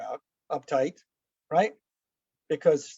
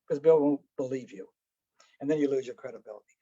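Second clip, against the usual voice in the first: ordinary talk, nothing marked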